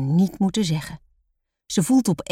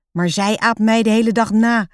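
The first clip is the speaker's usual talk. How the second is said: put-on higher, louder child's grumble; grumbling; 5 semitones higher, 5 dB louder than usual. A narrator's reading. Roos complaining to papa about her sister, grumbling. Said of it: "moppert Roos".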